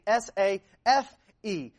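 S A F E, each letter named separately; spelled out. Shown as a demonstration. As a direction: spell out safe letter by letter, with emphasis. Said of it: "then you are safe".

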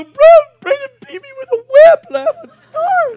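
very high, loud hammed-up crying; mock wailing child. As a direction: bawl like a tattletale kid, exaggerated. Imagined child wailing to parent.

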